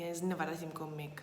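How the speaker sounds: ordinary delivery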